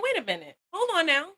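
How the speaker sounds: playful high wait-a-minute; mock objection. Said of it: "And I kept going".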